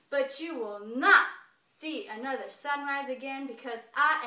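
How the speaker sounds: loud, menacing bear voice; bear's threat. A narrator's reading. Bear pinning the squirrel, loud and threatening. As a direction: growl it out as the angry bear.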